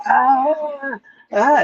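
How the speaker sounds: high-pitched but unforced retold scream; mock screaming. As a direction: imitate a cartoon scream without full volume. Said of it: "Bugs Bunny's screaming".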